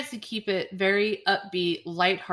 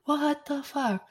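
stunned disbelief; 8 semitones higher, slower than usual. Real, not acted. Repeating reaction, drawn out.

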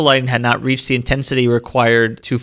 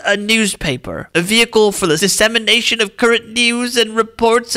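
put-on old-timey voice; 8 semitones higher than usual. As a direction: read it like a dying old man giving a grand speech. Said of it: "read it in an old-timey voice or dying old man voice".

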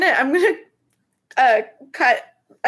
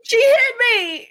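mock victim shout; 14 semitones higher than usual. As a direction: cry out like you were struck, overdone. Faking being attacked.

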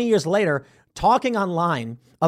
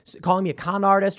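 ordinary talk, nothing marked

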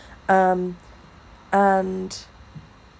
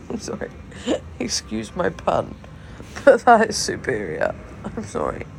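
groggy hungover voice; tired, hungover giggling; 3 semitones higher than usual. Real, not acted. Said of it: "I haven't been hungover in so long".

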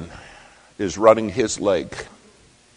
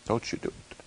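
much softer, breathed warning; pleading whisper; 5 semitones higher, 9 dB quieter, faster than usual. Retold or imitated. Friend begs Gunnar not to run, whispered. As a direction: beg him under your breath.

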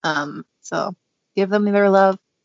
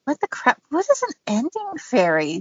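confused disbelief; 4 semitones higher than usual. Retold or imitated. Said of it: "And I'm like".

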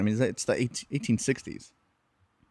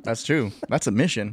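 usual speaking voice

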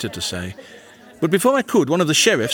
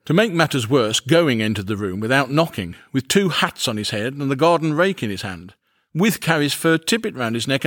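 ordinary delivery, nothing marked

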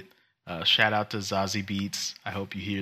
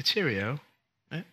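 token mock British accent; lightly faked British lilt; 5 semitones higher than usual. Shown as a demonstration. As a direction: toss off a bad British accent. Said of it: "Like a good British accent".

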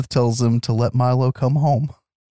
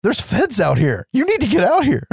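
urgent warning; 11 semitones higher than usual. Acted. Office lady's urgent warning acted.

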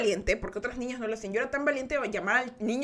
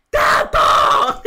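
put-on much louder, high household yell for the boy; calling out; 14 dB louder than usual. The relative calls Tato to the phone, loud yell. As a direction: holler his name across the house.

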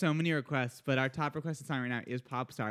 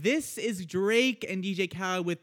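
higher, upbeat song intro delivery; radio announcer; 5 semitones higher than usual. Real, not acted. Host introducing the next song, radio announcer style. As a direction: throw to break like a radio host.

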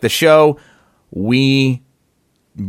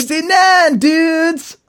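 squeaky mock dude voice; much slower than usual. Echoing the joke, higher.

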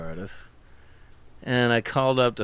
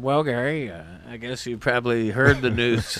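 usual speaking voice

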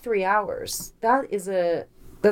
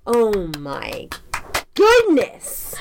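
really excited; 5 dB louder, much slower than usual. Real, not acted. Co-host reacts to guessing the story, overwhelmed with excitement. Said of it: "Sorry, I got really excited".

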